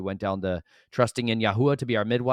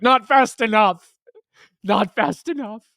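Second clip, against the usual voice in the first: much higher, louder, slow and drawn out; emphatic retort; 13 semitones higher, 12 dB louder, slower than usual. Retold or imitated. Dad answering a stranger's advice, loud slow exasperated cry. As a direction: blurt it out loud and dragged, half joking. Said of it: "they're always startled".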